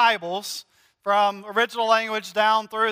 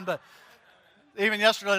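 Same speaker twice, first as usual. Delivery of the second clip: ordinary talk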